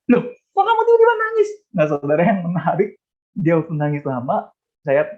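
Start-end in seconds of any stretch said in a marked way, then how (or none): 0.1-1.5 high-pitched, startled retold question; exaggerated puzzlement at sudden tears; bewildered dad. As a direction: act out a baffled father, pitch leaping up. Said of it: "Saudara, saya bingung".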